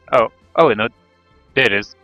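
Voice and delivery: ordinary talk, nothing marked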